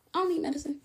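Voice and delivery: high, soft mocking voice; a mock singsong dismissal; mocking own denial. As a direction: parody her old stubborn self. Mocking her own stubborn self.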